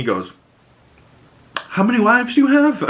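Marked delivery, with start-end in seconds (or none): 1.7-2.8 high, quick retold question; mock astonishment. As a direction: blurt it out in comic disbelief. Retold reaction to shocking offer.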